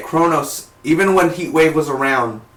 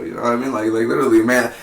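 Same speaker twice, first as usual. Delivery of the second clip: usual speaking voice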